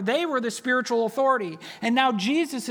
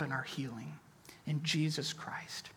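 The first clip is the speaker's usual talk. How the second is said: whispered; 6 semitones lower, 13 dB quieter than usual. Real, not acted. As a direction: let the name fall to a whisper. Soft intimate sermon close.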